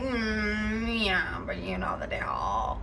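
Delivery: imitating a cold, nasty mom. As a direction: sneer it out under your breath. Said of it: "the tone of this woman's voice is so nasty".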